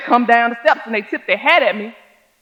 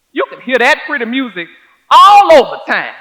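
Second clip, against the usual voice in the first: ordinary delivery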